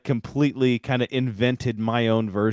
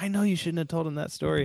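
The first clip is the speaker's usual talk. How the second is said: scolding aunt; 8 semitones higher, faster than usual. Retold or imitated. Aunt scolds uncle, retold.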